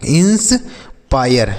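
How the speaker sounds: "Inspire" drawn out in two parts, ins... pire; slow demonstration. Shown as a demonstration. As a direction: break "inspire" after 'ins', very slowly. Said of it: "क्या पढ़ेंगे बच्चों?". ("Inspire" धीरे-धीरे, तोड़कर)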